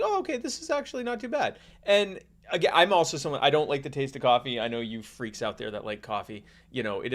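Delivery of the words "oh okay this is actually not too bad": surprised, pleasantly. Retold or imitated. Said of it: "and it's like".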